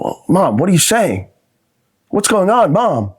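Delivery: high, baffled son; high confused pleading. Retold or imitated. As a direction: beg mom to explain.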